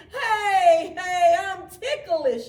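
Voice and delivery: high, loud, laughing protest; giggly snowman laugh. A narrator's reading. Frosty laughing as the children hug him. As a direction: giggle while being hugged. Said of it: "he said".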